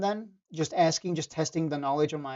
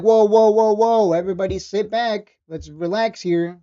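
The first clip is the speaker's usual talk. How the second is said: mock alarm; 3 semitones higher, 7 dB louder than usual. Imagining a jumpy stock, he calls everyone to relax. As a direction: hold your hands up and settle the room. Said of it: "then I'd be like".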